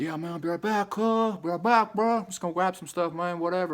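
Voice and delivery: breezy brush-off as he walks out; friend leaving. Retold or imitated. Friend heading out with stuff, high put-on buddy voice.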